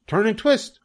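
very high goose honk. A narrator's reading. The goose coaches Wilbur in the chase, honking. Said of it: "honked the goose".

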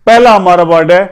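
ordinary delivery

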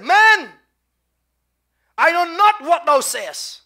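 high, shouted protest; vehement denial. Acted. Peter denies the Lord a third time, vehement shout. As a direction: shout it high, pausing after MAN.

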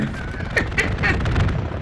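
high squealing laugh. Real, not acted. Laughing at near death.

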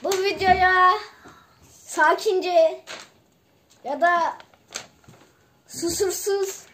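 loud, halting call, phrase by phrase. Real, not acted. Player to video viewers as a last word, loud halting call. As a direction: project it loudly, pausing between phrases. Child calls out closing message to viewers.

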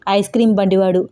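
ordinary delivery

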